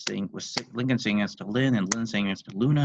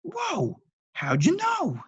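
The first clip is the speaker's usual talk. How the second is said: much higher astonished dad; dumbfounded; 4 dB louder, much slower than usual. Acted. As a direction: gasp in genuine amazement. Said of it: "genuinely dumbfounded".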